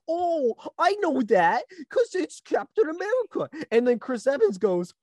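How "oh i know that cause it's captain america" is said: exaggerated squeal of recognition; mock fan excitement. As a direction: imitate a dopey fan thrilled to recognize it. Mimicking an audience recognizing the shield.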